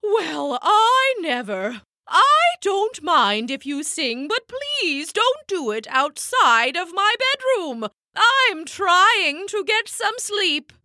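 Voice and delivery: indignant owl. Acted. Owl complains to grasshopper.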